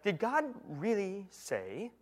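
slow, soft, insinuating tempter; sly serpent. Acted. Satan questions Eve, slow sly insinuation. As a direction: plant the doubt slowly and quietly. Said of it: "He said".

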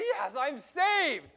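high, loud, slow cry of relief; relieved cheer. Retold or imitated. Little boy relieved his brother forgave him, cheering. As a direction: stretch it out like a kid let off the hook. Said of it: "and I'm like".

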